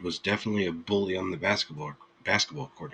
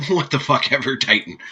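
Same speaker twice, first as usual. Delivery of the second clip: loud, higher, laughing brush-off; laughing protest; 6 semitones higher, 6 dB louder than usual. Real, not acted. Streamer brushing off a chatter's teasing, laughing. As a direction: scoff at the teasing through real laughter.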